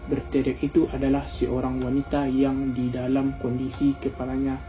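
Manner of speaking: usual speaking voice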